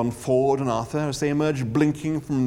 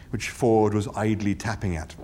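ordinary delivery

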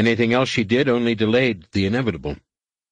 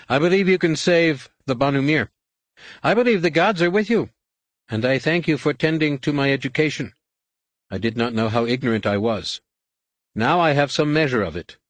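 lightly voiced novice, higher pitched; a touch of fervour in a raised pitch; earnest novice; 6 semitones higher than usual. A narrator's reading. Novice earnestly voices faith and thanks.

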